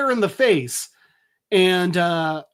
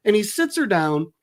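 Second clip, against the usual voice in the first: ordinary talk, nothing marked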